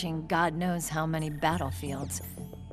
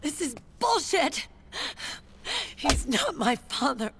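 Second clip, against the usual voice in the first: high-pitched, heated outburst; bitter anger; 9 semitones higher than usual. Acted. Niece disowning her father, bitterly angry. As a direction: spit out the denial, upset.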